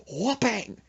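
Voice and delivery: high, loud "whoopang"; whip-smack sound effect. Retold or imitated. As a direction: punch out a cartoon blow. Imitated blow with a goldfish.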